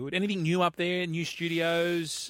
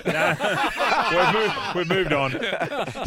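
laughing, louder and slow; 5 semitones higher, 8 dB louder, much slower than usual. Real, not acted. Co-host tells host the topic has moved on, laughing. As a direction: laugh while drawing it out.

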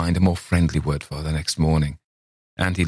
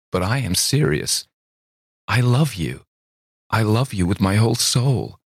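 higher, insistent confession; earnest young woman; 5 semitones higher than usual. A narrator's reading. Miss Thayer insists to laughing Campbell, earnest and pleading. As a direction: swear it with your whole heart.

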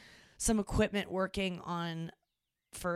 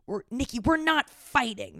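angry denial; 6 semitones higher, 7 dB louder than usual. Retold or imitated. Parents yelling at their kid that they aren't fighting. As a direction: snap back loudly, drawing it out.

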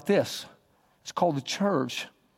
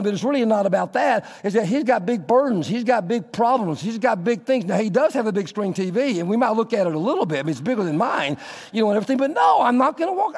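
higher, quick self-justifying rant; self-important excuses; 7 semitones higher than usual. Pretend. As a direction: reel off excuses, talking yourself out of it. Pastor talking himself out of it.

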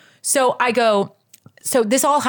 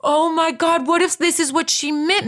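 higher, breathless wonder; dawning realization; 8 semitones higher than usual. Retold or imitated. Realizing the prophecy came true, high and amazed. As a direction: realize it all at once. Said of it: "And I'm like".

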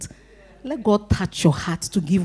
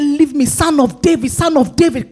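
raised, high pleading call; crying out; 6 semitones higher, 4 dB louder than usual. Retold or imitated. Blind man calling after Jesus as he passes, high crying out. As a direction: call out insistently over the people hushing you. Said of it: "crying out to Jesus".

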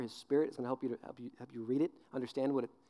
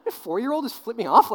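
high, loud disbelief; hammed-up incredulous question; mock shocked driver; 7 semitones higher, 10 dB louder than usual. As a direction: imitate a flabbergasted motorist.

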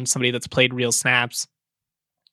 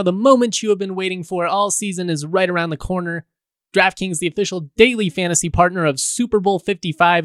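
hyped-up sponsor ad read; booming prize announcer; 4 semitones higher than usual. Acted. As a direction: sell it like a prize-show announcer. Reads a sponsor ad.

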